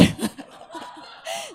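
high, breathy burst of laughter. Real, not acted. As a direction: laugh out high, caught off guard. Laughs at his own miracle.

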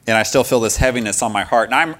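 usual speaking voice

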